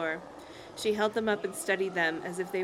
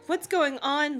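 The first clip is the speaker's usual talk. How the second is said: mildly raised, high-pitched cry; 7 semitones higher, 4 dB louder, slower than usual. A narrator's reading. Lindy sees the dummies, cries out in mild alarm. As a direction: call it out higher, in alarm. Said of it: "Lindy cried".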